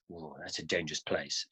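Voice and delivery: low, hushed warning; mock ominous warning. Local warns a traveller at the border, low and hushed.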